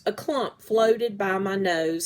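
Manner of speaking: ordinary delivery, nothing marked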